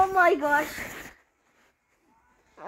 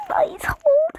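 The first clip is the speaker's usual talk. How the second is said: frightened pleading; 10 semitones higher than usual. Real, not acted. Begging in fear.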